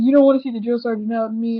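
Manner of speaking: loud, fast drill sergeant warning; mock drill sergeant. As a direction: bark it like a drill instructor. Former drill sergeant quoted.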